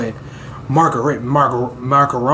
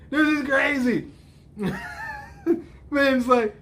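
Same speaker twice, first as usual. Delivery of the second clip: real laughter through the words; laughing; 10 semitones higher than usual. Real, not acted. Viewer cracks up at the silly scene, laughing. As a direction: laugh out the disbelief.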